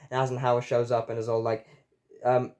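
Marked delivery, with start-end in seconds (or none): none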